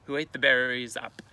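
usual speaking voice

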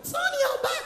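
put-on high, childlike squeak with a laugh; small child voice. Comic mimicking small children in class, high childlike voice. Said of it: "the way small children they do".

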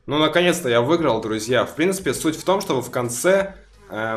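usual speaking voice

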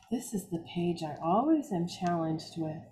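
usual speaking voice

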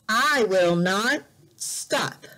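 normal talking voice

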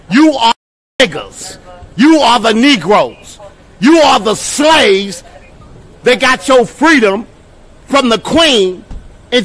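loud and slow, jabbing every word; angry shout. Real, not acted. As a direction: hammer each word out at them. Heated street argument with officers.

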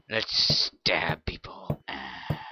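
slow menacing whisper; creepy whisper. Acted. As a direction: whisper it like a villain.

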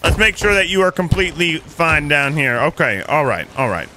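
usual speaking voice

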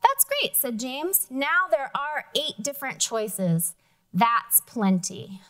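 lively storybook reading with character voices; reading to children. Acted. Teacher reading a picture book to the class, lively. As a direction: read it aloud to the class, bright and lively.

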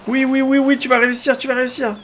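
root for yourself as you're nearly safe; excited cheering. Real, not acted. Gamer cheers his character on as it escapes, high and excited.

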